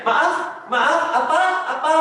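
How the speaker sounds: high, puzzled asking with a laugh; a confused customer who didn't hear you; pelanggan bingung. Acted. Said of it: "customer tentunya akan mundar-mandir nanya". (pelanggan bertanya bingung sambil tertawa kecil)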